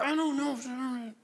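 put-on sulky oldest child. Oldest child answering parent, high slow whine. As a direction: drag out a sulky kid's reply. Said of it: "And they're".

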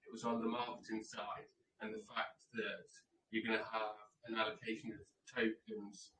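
quiet, distant mic sound; speaking far from the microphone. Real, not acted. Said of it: "put your mic next to your mouth".

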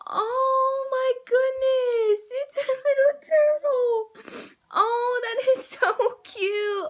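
real delighted squeal; excited squeal. Real, not acted. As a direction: gush over the tiny turtle. Squealing over a cute turtle.

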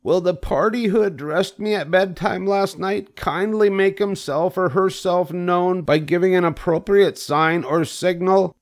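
very high, formal call for attention; loud, firm voice. A narrator's reading. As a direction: announce it firmly in a high pig voice. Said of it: "in a loud, firm voice".

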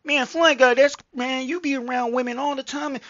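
high mock commenter voice; mock envious viewer. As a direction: put on a high voice, mimicking an onlooker. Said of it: "look at me and be like".

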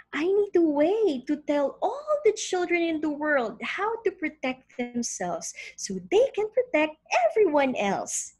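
a hint of an eager little girl; lightly voiced hopeful child; young girl voice. Sarah answers the voice.

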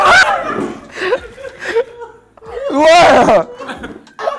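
high, loud pained laughter; shrieking laugh. Real, not acted. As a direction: laugh out the burning shock. Wasabi hits, shrieking laugh.

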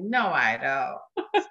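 low, very slow, laughing through it; stunned half-laughing denial; laughing disbelief. Retold or imitated. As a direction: laugh off the impossible news. Disbelief at twins.

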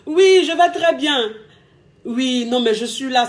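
relaxed cheerful phone voice; acting carefree. Shown as a demonstration. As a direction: sound happy and unbothered. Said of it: "Toi aussi, tu fais un genre".

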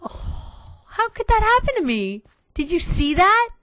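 high, whiny cry of dismay; dismayed wail. Real, not acted. Gamer wailing to viewers over lost cart, high whine. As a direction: wail it like the world just ended.

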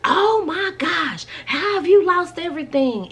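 high, loud, slow mocking shriek of the rude clerk; hammed-up loud, rude clerk voice. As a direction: screech it at her like a rude clerk. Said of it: "like, loud and rude".

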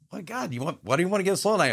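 replaying his baffled pushback at the voice; incredulous protest. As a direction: protest the odd order in disbelief. Arguing with God's voice.

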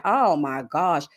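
slow, low "oh my gosh"; exasperated. Retold or imitated. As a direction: drag it out, fed up. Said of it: "I'm just like".

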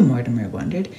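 ordinary delivery

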